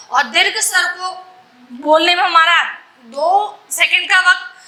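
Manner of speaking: usual speaking voice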